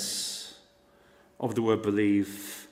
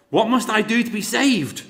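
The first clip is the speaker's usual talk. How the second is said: crying out; 10 semitones higher, 5 dB louder than usual. Retold or imitated. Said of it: "the prison guard cried outwardly".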